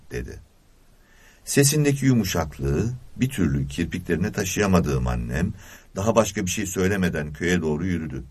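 ordinary delivery

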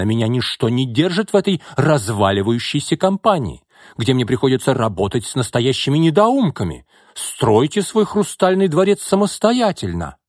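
high-pitched furious outburst; angry shouting. A narrator's reading. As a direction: rage as you storm out of the job. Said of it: "гневно кричал Яков". (гневный крик)

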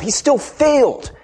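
ordinary talk

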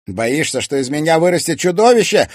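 loud, high, accusing question; bitter challenge. A narrator's reading. Son challenging his mother's fear of him, bitter. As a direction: climb into a heated question.

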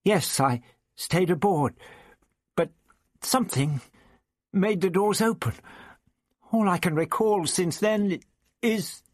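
hesitant voice climbing in distress; helpless faltering. A narrator's reading. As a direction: grope anxiously for an explanation. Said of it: "she gestured helplessly".

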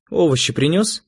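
businesslike wife. A narrator's reading. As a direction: check on the shopping matter-of-factly. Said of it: "деловито спросила Светлана".

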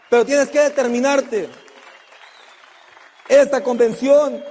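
high, loud rally-the-crowd speech; motivational preaching. Real, not acted. Motivational speech at full force. (arenga motivacional a toda voz)

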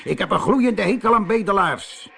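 angry shout. A narrator's reading. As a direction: spit out your hatred of beggars. (boos geschreeuwd)

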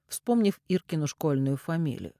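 usual speaking voice

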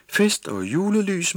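high, slow drowsy murmur; sleepy mumble. A narrator's reading. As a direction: drift off as you say it. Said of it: "mumlede hun så". (søvnig mumlen)

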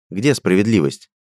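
plain reading voice

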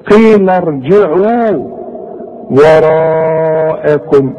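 drawn tajweed recitation, وراءكم held long; slow recitation. Shown as a demonstration. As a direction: recite slowly, stretching وراءكم. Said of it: "المد ده احنا ما خدناهوش". (تلاوة مرتلة بطيئة)

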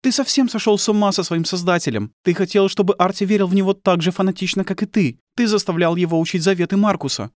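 high-pitched, bitter woman's voice; accusing wife. Acted.

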